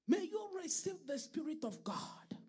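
loud, forceful blessing over the congregation; fervent preached prayer. Real, not acted.

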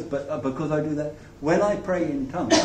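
normal talking voice

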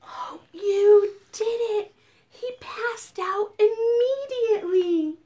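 pleased, lifted read-aloud; delighted surprise. A narrator's reading. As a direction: exclaim with delight that it worked. Story celebrates dinosaur falling asleep.

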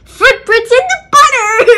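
excited punchline. Real, not acted. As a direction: blurt it out gleefully.

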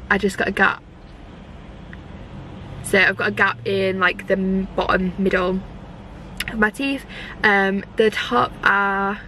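talking with finger in mouth; finger pulling lip down. Real, not acted. Seen 0: Finger pulls lower lip down to show braces; briefly released near 4-5s.

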